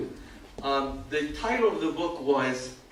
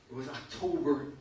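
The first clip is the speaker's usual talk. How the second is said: ordinary delivery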